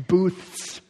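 "Booths" on its own, ending in a long ths; over-articulated "Booths"; careful pronunciation. Shown as a demonstration. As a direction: stretch the hissing end of "Booths". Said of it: "That's a hard word to say".

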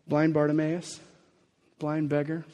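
ordinary delivery

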